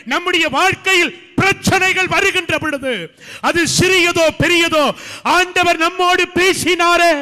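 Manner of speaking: high, loud preaching cry; full-force preaching. Acted. Pastor proclaiming the promise to the church, loud and high. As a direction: thunder it out to the congregation.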